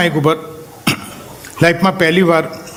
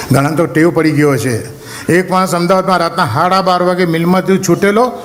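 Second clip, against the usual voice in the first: usual speaking voice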